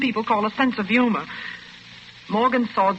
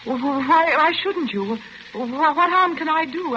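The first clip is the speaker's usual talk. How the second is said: higher, stammering, slowing fear; frightened stammer; 5 semitones higher, slower than usual. Acted. Dorothy realising Rolfe won't let her go, stammering in fear. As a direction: stumble over the words, suddenly scared.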